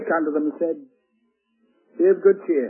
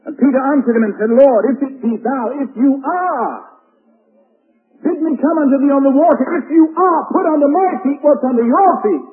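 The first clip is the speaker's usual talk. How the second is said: higher and louder, driving home each phrase; full-force pulpit preaching; fiery preaching; 8 semitones higher, 7 dB louder than usual. Acted. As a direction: preach it with rising fervour.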